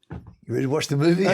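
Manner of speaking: mock-cheerful invite to a teen; eager dad. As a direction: sell it like a keen dad. Imagining asking teen son.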